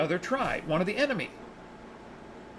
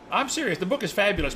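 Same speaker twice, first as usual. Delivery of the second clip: ordinary delivery, nothing marked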